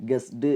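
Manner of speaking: "ഗസ്ഡ്" with a voiced -du; wrong du ending. Shown as a demonstration. Teacher shows how we wrongly say it, "ഗസ്ഡ്" ending -du. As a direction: say "ഗസ്ഡ്" as gues-du, vowel at the end. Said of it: "അങ്ങനെയല്ല പറയാ".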